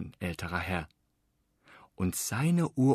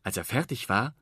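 ordinary delivery, nothing marked

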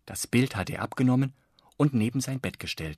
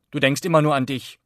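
put-on louder, sharp reproach from a boy; annoyed; 5 semitones higher, 9 dB louder than usual. A narrator's reading. Floh scolds Langeweile for her selfishness, annoyed and louder. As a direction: tell her off, stung on his great-grandmother's behalf. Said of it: "sagte er verärgert".

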